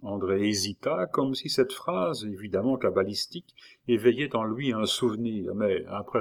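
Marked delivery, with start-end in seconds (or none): none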